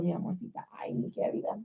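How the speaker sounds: soft whispered endearments. Real, not acted. Teacher whispering sweetly to her young daughter. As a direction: whisper it tenderly to the little one. Tender aside to child.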